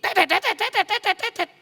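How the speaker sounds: high mock printer beeps; rising chirpy beep noises. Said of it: "di print bunyi".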